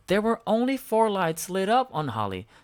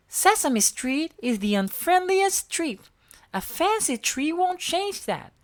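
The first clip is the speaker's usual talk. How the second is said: put-on high, lighter girl's voice; a scornful little girl; Smudge voice; 13 semitones higher than usual. A narrator's reading. Smudge scoffing at the tree, high and sour. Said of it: "said Smudge".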